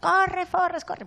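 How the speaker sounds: imitated movie cry, pitched high; Forrest Gump-style shout. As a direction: mimic someone urging a runner away. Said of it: "tipo Forrest Gump".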